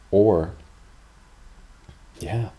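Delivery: ordinary talk, nothing marked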